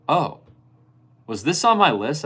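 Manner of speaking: high, loud, fake-innocent surprise; mock surprise. Friend at Secret Santa opening his gift, high mock surprise. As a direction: play the friend who forgot his own wish.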